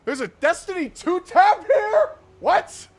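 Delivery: high, loud, incredulous shout; shocked outburst. Real, not acted. Streamer spotting a Destiny two tab, shocked shouting. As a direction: shout it in drawn-out disbelief. Outraged at website's game tab.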